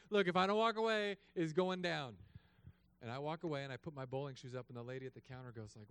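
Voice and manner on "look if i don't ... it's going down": angry threat. Retold or imitated. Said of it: "I'm like".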